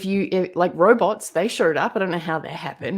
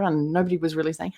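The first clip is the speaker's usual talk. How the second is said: normal talking voice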